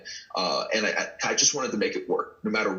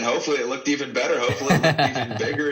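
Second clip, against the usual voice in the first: laughing; 5 semitones higher, 4 dB louder, faster than usual. Real, not acted. Laughing while recalling his big move.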